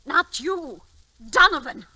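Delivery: loud, high, accusing cry; anguished outburst. Acted. Wife confronting husband over the madhouse, loud and anguished. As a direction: throw the betrayal back at him, full voice.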